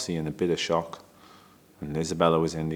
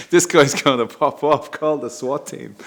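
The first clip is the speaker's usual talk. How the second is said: higher and louder, playing the staff in a panic; mock alarm; 8 semitones higher, 6 dB louder than usual. The staff watching his outburst, a mock alarmed yelp. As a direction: ham up their worry that he'll snap.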